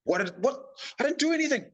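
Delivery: mock panic, voice climbing high; alarmed. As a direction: pitch shooting way up in panic. Panicked reply to being summoned.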